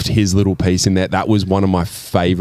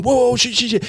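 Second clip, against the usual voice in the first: high mock panicky cursing; Buscemi's panic; faster than usual. Buscemi's character surrounded by the brothers, high panicky curses. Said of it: "he's going".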